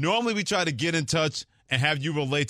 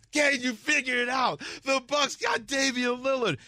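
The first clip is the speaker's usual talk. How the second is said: high, shouted overjoyed fan yelling at strangers; Harry losing it; 12 semitones higher than usual. Retold or imitated. Imagining Harry telling Ferry strangers the news, shouting with joy. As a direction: yell the trade news at everyone, beside yourself. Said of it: "how Harry would sound if this happened".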